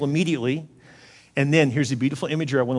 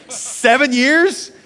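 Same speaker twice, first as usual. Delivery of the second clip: incredulous; 9 semitones higher, 4 dB louder, much slower than usual. Real, not acted. Incredulous at seven years.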